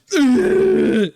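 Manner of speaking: loud, high-pitched mock sob; imitation of ugly crying; imitated ugly sob. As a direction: burst out with a short, high sobbing noise. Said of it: "like, ugly sobbing".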